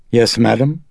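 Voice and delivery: ordinary delivery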